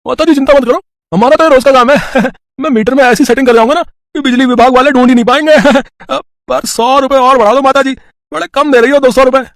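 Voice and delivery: high, loud, cheeky repairman voice; sly electrician. Acted. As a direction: play the chuckling crooked electrician. Electrician character voice. (चालाक बिजली वाला, ऊँची और तेज़ आवाज़ में)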